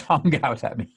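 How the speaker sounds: usual speaking voice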